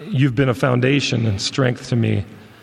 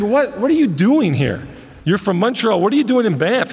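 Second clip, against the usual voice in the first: higher-pitched, animated retold surprise; puzzled retold question, pitched up; surprised questioning; 6 semitones higher than usual. Preacher retelling his questions to a young man from Montreal, surprised. Astonished question to stranger in story.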